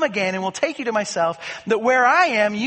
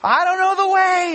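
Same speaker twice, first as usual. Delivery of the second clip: raised, pleading disciple voice; a worried follower protesting; Thomas, puzzled; 6 semitones higher, 4 dB louder than usual. Acted. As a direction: Thomas pushing back, anxious and higher. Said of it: "Thomas is saying".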